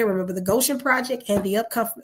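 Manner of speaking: usual speaking voice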